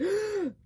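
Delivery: waking from a vivid dream with a gasp. Retold or imitated. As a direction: jolt awake with a startled gasp. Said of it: "it was just like this".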